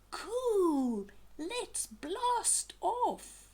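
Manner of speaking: high, slow, thrilled cheer; excited Burple. Acted. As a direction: drag out the blast-off with glee. Burple excited about rocket.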